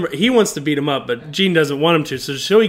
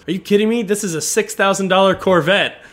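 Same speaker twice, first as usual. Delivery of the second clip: raised, louder mock outrage; acted-out incredulous objection; indignant disbelief; 5 semitones higher, 4 dB louder than usual. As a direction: protest as if the idea is absurd. Acting out outraged reply to suggestion.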